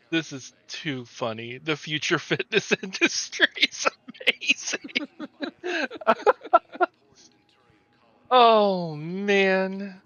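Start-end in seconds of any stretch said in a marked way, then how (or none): 1.6-10.0 breaking into high laughter; laughing. Real, not acted. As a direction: laugh through the words. Laughing at the glitching character.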